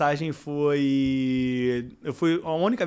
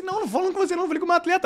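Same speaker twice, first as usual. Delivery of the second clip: much higher, defensive protest; protesting innocence; 14 semitones higher, much faster than usual. Retold or imitated. Coach protesting to the referee, higher and insistent. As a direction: insist you weren't talking to her. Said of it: "Eu falei assim".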